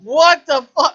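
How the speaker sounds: loud, high outraged cry; exasperated yell. Retold or imitated. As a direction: shout it in disbelief. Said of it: "And you're like".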